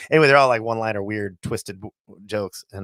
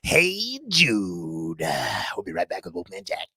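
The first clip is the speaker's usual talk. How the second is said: low, drawn-out radio DJ patter; Wolfman Jack; 6 semitones lower, 4 dB quieter, slower than usual. Acted. DJ announces a song and a break, Wolfman Jack voice. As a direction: stretch the song title like an old DJ. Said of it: "That's how he sounded".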